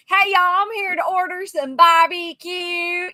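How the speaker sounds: exaggerated Southern drawl, slow; stupid Southern accent. Mocking a customer at barbecue place, silly Southern accent. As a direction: ham up a Southern twang. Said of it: "this stupid accent".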